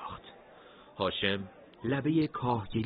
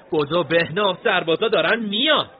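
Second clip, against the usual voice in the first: high, loud cry to run; urgent shout; 10 semitones higher, 11 dB louder than usual. A narrator's reading. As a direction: yell at your friend to flee. Fleeing soldiers, pulling friend. (فریاد بلند و فوری برای فرار)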